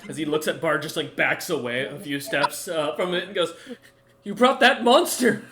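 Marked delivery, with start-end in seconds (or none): 4.2-5.4 high, loud, hurried accusation; distraught outburst. Acted. Game master voices creature reliving its kills, distraught. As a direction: pour out the horror, high and loud.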